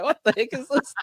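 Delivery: very high, puzzled mock voice; S1 voicing baffled Aussies at a Māori feed, very high and teasing; mock bewildered local. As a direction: squeak it out like a confused local.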